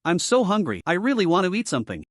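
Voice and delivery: ordinary delivery, nothing marked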